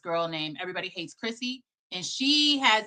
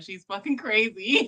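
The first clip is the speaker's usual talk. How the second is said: ordinary talk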